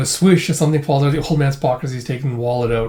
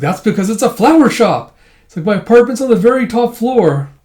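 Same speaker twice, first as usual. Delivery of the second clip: higher, emphatic retort; acted exasperated correction; 7 semitones higher, 4 dB louder than usual. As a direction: point out the obvious, mock exasperation.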